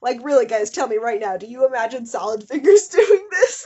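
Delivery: loud, laughing disbelief at the reader; half-laughing loud outrage. Real, not acted. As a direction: demand an answer, cracking up. Incredulous outburst at fanfic.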